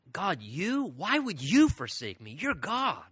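incredulous question, pitch swooping on "you"; baffled disbelief. Retold or imitated. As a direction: question God in bewildered disbelief. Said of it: "It feels like it's so strange".

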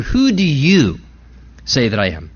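"you" held out, then a pause; stress on "you". Acted. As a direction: lean on "you", then let the question drop. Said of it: "the emphasis truly there is on the word you".

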